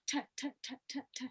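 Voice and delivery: soft quick taps of T behind the teeth; bouncing t. Shown as a demonstration. Coach drums T on the ridge, soft quick taps. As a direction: tap T like a drum. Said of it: "T is the tip of your tongue bouncing".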